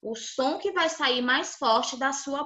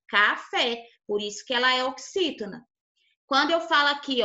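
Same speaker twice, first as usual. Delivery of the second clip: normal talking voice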